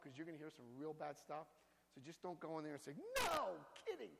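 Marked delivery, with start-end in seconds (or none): 3.2-4.1 high, over-the-top disbelief; mock shocked reaction. Sponsor failing to hide shock at a confession, mock shocked. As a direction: react as if you can't keep a straight face. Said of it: "try to stay straight-faced".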